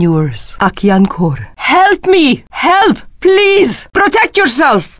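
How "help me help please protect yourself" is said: high-pitched urgent calls; cry for help. Acted. Warrior calling allies for help, high urgent cries.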